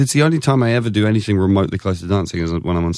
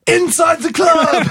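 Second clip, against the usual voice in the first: high slow shouted drop; much slower than usual. Retold or imitated. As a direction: yell it as the beat drops. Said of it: "and then he goes".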